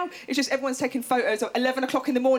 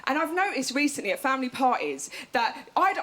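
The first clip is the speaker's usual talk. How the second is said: ordinary talk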